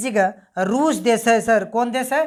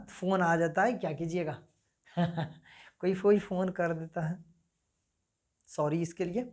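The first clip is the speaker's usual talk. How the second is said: soft apology; 4 semitones lower, 9 dB quieter than usual. Real, not acted. Apologizing for the phone interruption.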